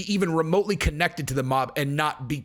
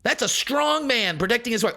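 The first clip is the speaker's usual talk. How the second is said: much higher mock-earnest defender voice; 6 semitones higher than usual. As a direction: parody a smug defender. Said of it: "half the people are all".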